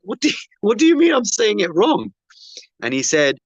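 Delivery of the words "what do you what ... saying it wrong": high-pitched retold disbelief with a laugh; baffled comeback, voice raised high; incredulous retort. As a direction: protest in amused disbelief, pitch jumping up. Retelling his baffled retort in argument.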